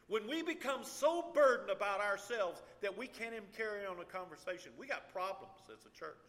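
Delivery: loud, high preaching at full force; fervent preaching. Real, not acted. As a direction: preach it to the congregation.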